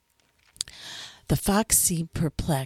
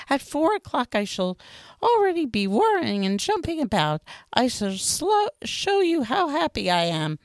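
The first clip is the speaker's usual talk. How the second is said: higher fox voice, earnest and pleading; fox character voice; 10 semitones higher than usual. A narrator's reading. Fox explains to the little prince what taming means, higher and earnest.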